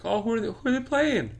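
high, airy mock-mom voice; imitating mom's sweet high question. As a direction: play the clueless mom, way up high. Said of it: "she would be like".

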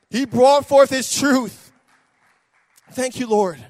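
slow, loud proclamation; slow declaration; emphatic preached line. Acted. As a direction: land it slowly and hard.

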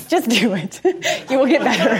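words spoken through real laughter; quick, amused advice between laughs; laughing. Real, not acted. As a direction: chuckle while giving the advice. Laughing at her own story.

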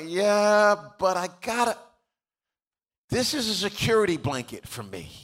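high-pitched, hesitant pleading; hammed-up clinging protest; mock reluctance. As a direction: act a believer making excuses to hold on. Voicing believer reluctant to let go.

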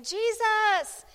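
high, loud panicked plea for rescue; panicked yelling. Retold or imitated. Disciples yelling at Jesus in the storm, panicked and loud. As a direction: cry out in terror to a sleeping master. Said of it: "and they're yelling".

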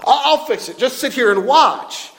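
put-on soothing a crying child. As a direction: hush her tears, promise to fix it. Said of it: "he says".

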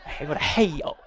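laughing, hammed-up hellfire preacher line; mock Southern preacher. Pastor mocking a fire-and-brimstone preacher, laughing through it. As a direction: tease the Southern preacher's drawled hail. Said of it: "You know, it's like".

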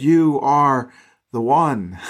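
slow, drawn-out "You are the one"; emphatic declaration. Retold or imitated. People who saw the miracles declare "You are the one", slowly, emphatically. Retold believers recognizing Jesus.

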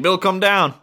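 raspy cowboy drawl, lightly done; John Marston accent. Retold or imitated. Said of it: "I have to do the accent every time I'm imitating John".

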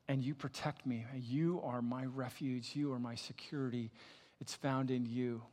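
hushed psalm prayer; prayerful scripture reading. Acted. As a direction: pray it quietly to God. Reads Psalm sixteen as prayer.

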